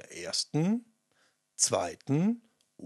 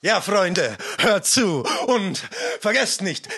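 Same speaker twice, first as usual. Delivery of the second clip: higher and louder, climbing toward the end; rally the friends to sing; loud cheerful invitation rising to the last word; excited call-out; 6 semitones higher, 5 dB louder than usual. Acted. Final take, calling out.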